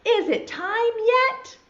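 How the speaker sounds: high, drawn-out impatient call; really loud. Acted. Impatient caterpillar can't wait to become a butterfly, loud. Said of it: "really loud".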